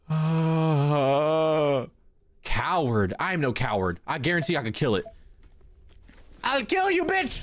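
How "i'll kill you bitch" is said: high angry yell; furious shout. Real, not acted. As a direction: roar it as you attack. Attacking the monster.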